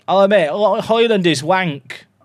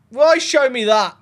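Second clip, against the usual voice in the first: louder, higher cry of disbelief; why show me that, raised in frustration; exasperated outburst; 8 semitones higher, 5 dB louder than usual. Real, not acted. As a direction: protest at the screen. Exasperated at the game.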